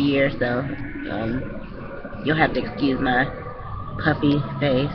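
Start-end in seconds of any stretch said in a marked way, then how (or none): 2.2-4.9 stuffy voice from a cold; muffled voice. Real, not acted. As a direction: speak through a blocked nose. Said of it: "muffled voice".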